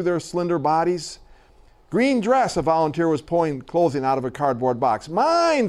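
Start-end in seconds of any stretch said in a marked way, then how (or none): 1.9-2.6 high-pitched mimicked child's call; children's eager demand. A narrator's reading. Reader voicing children grabbing donated clothing, eager high-pitched demand. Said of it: "screeched every girl voice". 5.1-5.7 mild mock screech. A narrator's reading. Reader voicing children grabbing donated clothes, high mock screech. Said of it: "screeched every girl voice".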